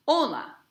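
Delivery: "Hola" with a high, accented O; "Hola" stressed firmly on the first syllable. Shown as a demonstration. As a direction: punch the O in "Hola", then drop. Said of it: "on the O".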